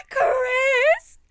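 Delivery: high, loud happy shout of a name; mock excited call. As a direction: call out to a friend across the door. Friend runs up calling his name.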